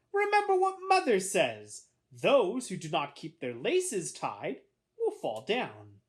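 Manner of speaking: high opening, dropping into a slow warning; lecturing big sister. A narrator's reading. Sister at the door warning her brother, lecturing. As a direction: recite Mother's rule as a warning.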